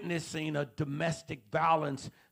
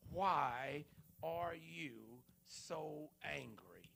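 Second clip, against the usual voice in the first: very slow and much softer, drawing out each word; hushed searching question; 11 dB quieter, much slower than usual. Acted. Preacher quietly asks the audience why they are angry. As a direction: let the question sink in gently.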